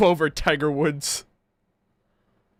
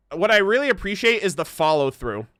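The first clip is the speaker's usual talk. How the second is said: ordinary talk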